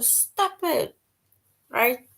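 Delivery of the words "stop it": high sharp demo shout, pitched way up; mock shout. Shown as a demonstration. Teacher demoing a shouted command for students. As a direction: show a shout of stop it. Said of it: "where you are shouting or screaming".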